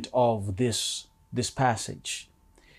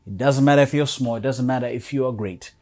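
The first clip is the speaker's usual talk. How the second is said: ordinary talk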